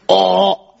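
practice Essex "oh", drawn out; Essex accent. Shown as a demonstration. Comic warming up for an Essex guest, Essex accent. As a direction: find the Essex accent on one exaggerated vowel. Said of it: "I've got to get the accent".

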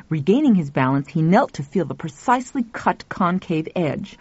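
plain reading voice

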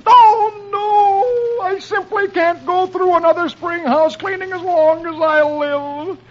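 high, wailing comic scream; Dagwood. A narrator's reading. Dagwood dreading house cleaning, high anguished scream. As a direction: cry out in hammed-up despair. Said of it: "Dagwood screams".

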